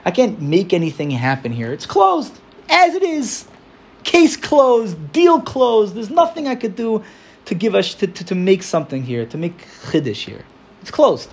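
high, whining hopelessness; despairing lament. Retold or imitated. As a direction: moan that everything is closed. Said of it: "and we think".

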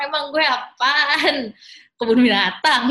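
loud, exaggerated protest with laughter close behind; mock indignant outburst. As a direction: protest loudly at being stared at, half joking. Menirukan protes kesal saat ditatap orang. (protes kesal yang lantang, setengah bercanda)

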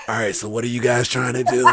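low, soft, understated voice; Moxley's real talk. Retold or imitated. Wrestler turning up for the promo shoot, low imitated voice. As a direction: imitate him low and casual. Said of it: "That's how he really talk".

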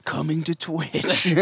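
slow, laid-back trailer announcer; cool voice. Pretend. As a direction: drawl it like a cool promo. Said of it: "in the cool voice".

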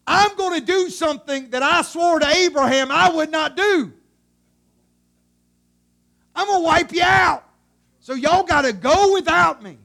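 loud, high, booming threat; fed-up God, shouting his warning; aggravated God. Acted. God fed up with the Israelites, aggravated shouting. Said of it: "he is so aggravated".

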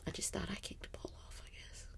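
soft unvoiced whisper. Real, not acted. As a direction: let it fade to a whisper. Grieving, trails off.

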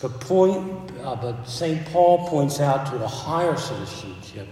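loud, ringing pulpit delivery; full-force preaching. Acted. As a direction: proclaim it to the back pew.

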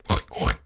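low snorting oink; pig's oink grunt. Acted. Pig calling to the spider, low grunts. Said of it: "grunted the pig".

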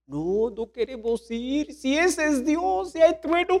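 high, drawn-out timid refusal; mock frightened. The people refusing Moses at Sinai, mock frightened. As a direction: rise slowly into a hammed-up scared whine. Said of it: "a nosotros nos da miedo".

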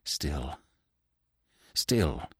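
low, very soft, halting repetition; stammered. A narrator's reading. Frightened widow clings to her oath, stammering faintly. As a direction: falter on the word with a long pause. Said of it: "she stammered".

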